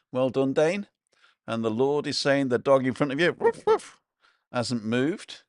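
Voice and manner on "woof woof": squeaky little woofs, pitched way up; mock dog bark. Reading a viewer's comment, a high mock dog bark. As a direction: yap it out like a tiny dog.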